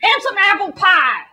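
hammed-up defensive wail, dragged out at the end; loud spoilt-glutton protest; mock American. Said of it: "Americans are all like".

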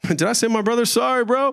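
laughing, high-pitched joking apology; playful, lifted voice; amused aside. Real, not acted. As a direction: break off with a chuckle. Jokey aside apologizing to brother.

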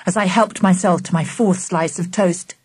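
ordinary delivery, nothing marked

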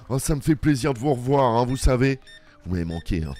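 ordinary talk

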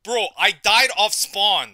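furious gamer shout; loud frustrated rant. Real, not acted. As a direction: yell it at the screen. Raging about dying.

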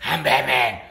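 low, terse Batman growl; gruff Batman. Acted. Batman answering the announcer with his catchphrase. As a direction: answer deep and flat like Batman.

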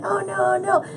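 high, soft mock distress; mock struggling. Acting out a fake struggle on camera, mock distress. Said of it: "I'm struggling, like".

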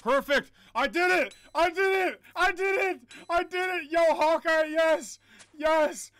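high, loud triumphant yells; victory shouting. Real, not acted. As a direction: scream with joy. Victory after near death.

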